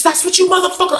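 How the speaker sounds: high-pitched mock holler; acted angry outburst.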